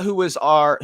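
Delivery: usual speaking voice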